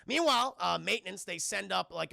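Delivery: ordinary talk, nothing marked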